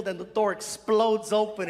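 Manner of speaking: normal talking voice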